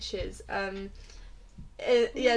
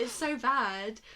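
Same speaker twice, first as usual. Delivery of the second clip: ordinary talk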